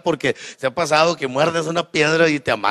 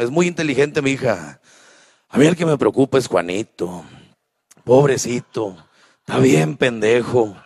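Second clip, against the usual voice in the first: lower, matter-of-fact dad voice; father talking to wife; 4 semitones lower than usual. Retold or imitated. Father explains to wife which kids need nothing. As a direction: speak low like a sure old father.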